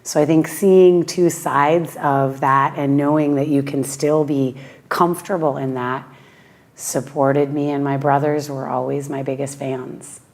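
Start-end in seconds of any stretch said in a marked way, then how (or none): none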